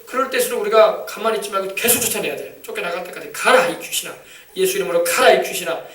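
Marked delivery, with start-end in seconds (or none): 3.3-5.8 building, louder and louder rebuke; commanding exorcism. Shown as a demonstration. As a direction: drive the spirits out with rising force. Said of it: "막 명령하시길 바랍니다". (점점 커지는 축귀 명령)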